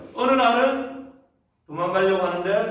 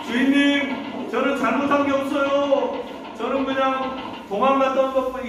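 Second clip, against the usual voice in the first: pleading protest; 7 semitones higher than usual. Acted. Accused Joseph to his master, pleading.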